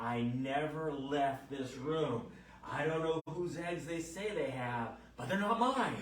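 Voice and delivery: slow, drawn-out insistence rising high and loud; adamant denial. Retold or imitated. Patient denies the eggs are hers, slow adamant protest. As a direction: mimic a patient flatly refusing to believe.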